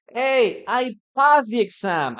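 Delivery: loud, high, excited call; student shouting about the passed exam; happy shout. Retold or imitated. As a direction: shout the good news. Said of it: "you start, like, uh, shouting that".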